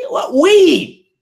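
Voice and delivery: sudden high, loud "what?"; outraged disbelief. Real, not acted. Reacting to the crowd's "we", a shocked yell. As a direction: fire it back in shocked indignation.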